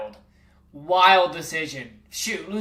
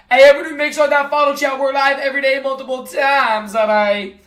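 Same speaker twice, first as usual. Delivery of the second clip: higher, louder, quick announcer push; hype stream plug; 5 semitones higher, 5 dB louder than usual. Real, not acted. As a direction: sell the stream fast and loud.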